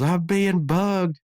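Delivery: high, slow, drawn-out paranoid whine; Dale Gribble impression. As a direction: stretch it out in a high, panicked nasal Dale voice. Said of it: "Dale Gribble goes".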